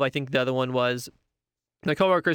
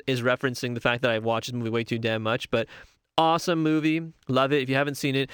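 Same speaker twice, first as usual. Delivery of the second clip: normal talking voice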